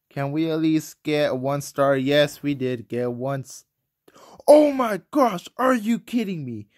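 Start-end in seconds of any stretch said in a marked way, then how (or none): 4.5-6.7 much higher and louder exasperated outcry; losing it at the game; real gamer rage at a failed raid; raging. Real, not acted. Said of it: "I am raging right now".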